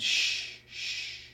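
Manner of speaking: whispered shh, very soft; soothing hush. Acted. Father cradling his sobbing son, whispered hush. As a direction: hush him gently like a baby.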